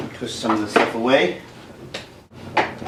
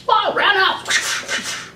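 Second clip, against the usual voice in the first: very high, squeaky fighter yelp; play-fighting falsetto kick call; mock kung-fu cries; much slower than usual. Imitating toy fight kicks.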